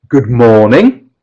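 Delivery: "morning" drawn out with a rising tune; nuclear stress on the first syllable of "morning". Shown as a demonstration. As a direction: lean slowly on "morning", lifting at the end. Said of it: "I'm nodding on morning".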